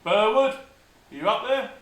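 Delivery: high drawn-out call upstairs; calling up to window. Real, not acted. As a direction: call the name up, slowly.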